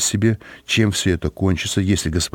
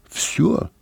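dejected; 9 semitones higher than usual. A narrator's reading. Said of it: "сказал с унынием Симоне".

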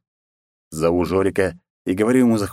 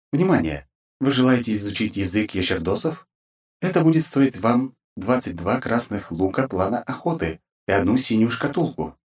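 filtered system notification voice; formal automated alert through a filter; game system announcer; 3 semitones higher than usual. Acted.

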